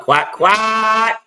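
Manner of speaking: high playful quack, quack; duck quacking voice. Pretend. Duck call for Oregon pick.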